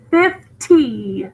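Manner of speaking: "Fifty" as FIF, short gap, crisp TEE; split into syllables. Shown as a demonstration. Teacher counting syllables, "Fifty" split as fif...tee. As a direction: break "Fifty" in two, sounding the T clearly. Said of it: "How many syllables?".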